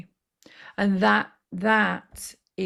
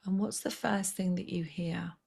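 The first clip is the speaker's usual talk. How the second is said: ordinary delivery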